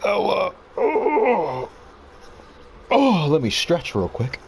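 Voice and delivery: ordinary delivery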